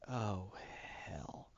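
very low, hushed realization; sinking dread. Retold or imitated. Spider-Man realizing his fear is true, low hushed dread. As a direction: let it sink away almost to nothing.

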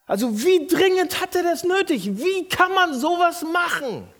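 high, indignant, stretched out; outraged disbelief. Retold or imitated. Pastor reliving his anger in counseling.

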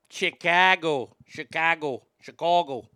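Chicago accent, stretched stressed vowel. Shown as a demonstration. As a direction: savor the city name in Chicago accent. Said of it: "Chicago's one of those fun accidents".

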